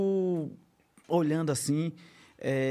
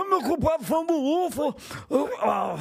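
put-on higher, weepy old man voice; 7 semitones higher than usual. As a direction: play a tearful old man, slowly. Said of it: "eu sou ruim imitador".

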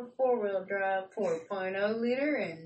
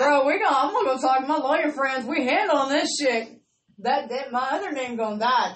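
loud, laughing, fast declaration; loud laughing vow; 4 semitones higher, 8 dB louder than usual. Retold or imitated. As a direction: swear it like you mean business, laughing. Vowing to kill the old name.

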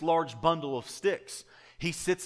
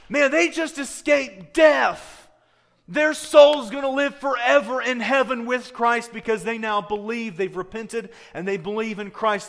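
much higher and louder, slow and shouted at first; amazed exclamation; 10 semitones higher, 11 dB louder than usual. Acted. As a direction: proclaim it like astonishing news. Voicing the right attitude.

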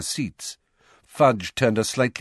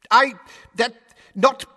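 broken, loud, higher stammering fluster; blustering; 7 dB louder than usual. A narrator's reading. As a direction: splutter as if caught out. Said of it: "blustered Fudge".

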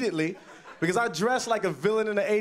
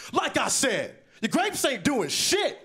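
high-pitched, insistent high schooler; 6 semitones higher than usual. Retold or imitated. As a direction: drive the comeback home, pitched up. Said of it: "He's like".

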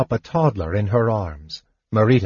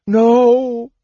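very high, loud anguished cry; high-pitched wail of grief; heartbroken shriek; 13 semitones higher, 6 dB louder than usual. Acted. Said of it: "shrieked Matafleur in a heartbroken voice".

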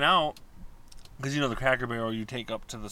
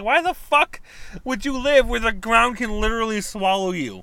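much higher and louder, incredulous; exasperated outburst; 9 semitones higher, 7 dB louder than usual. Retold or imitated. Woman retelling her reaction to Florida sinkholes, loud and incredulous. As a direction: blurt it in disbelief at the news. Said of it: "I'm like".

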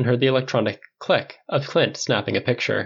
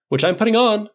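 higher, eager interjection; cutting in; 10 semitones higher, 4 dB louder than usual. A narrator's reading. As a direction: cut in with a rising pitch. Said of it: "Clint cut in".

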